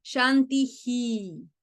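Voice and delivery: "Shanti hi" said slowly, the i repeated after a breathy h as "hi"; echoed vowel. Shown as a demonstration. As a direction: stretch it out: shanti, then a clear hi. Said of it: "la vocal que está antes se replica". (pronunciación lenta: shanti-hi, repitiendo la i)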